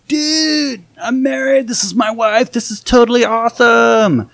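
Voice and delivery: high, far-off voice of a tipsy bro, slowing at the end; drunk excited newlywed. Retold or imitated. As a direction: play the drunk husband gushing about his wife. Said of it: "and he's like".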